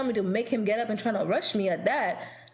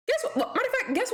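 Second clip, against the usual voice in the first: mocking dancer; 12 semitones higher, faster than usual. Dancer voiced in story.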